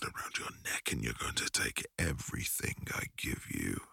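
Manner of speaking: low, soft, breathy threat; dark whispered promise; menacing whisper. Acted. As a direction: hiss it close to her ear.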